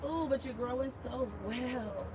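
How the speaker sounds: soft, slow, doting coo; talking to a plant. Real, not acted. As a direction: coo over it like a proud parent. Praising a plant.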